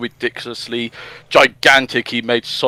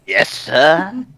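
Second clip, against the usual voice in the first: lightly voiced meek servant; 4 semitones higher than usual. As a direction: answer like a timid assistant. Grimer voiced answering Robotnik.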